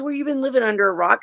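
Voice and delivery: high, fast incredulous friend; rapid high-pitched ribbing; retold disbelief. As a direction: imitate a friend who can't believe it.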